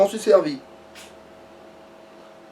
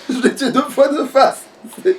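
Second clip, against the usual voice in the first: laughing; 4 semitones higher than usual. Real, not acted. Gloating about killing the prince.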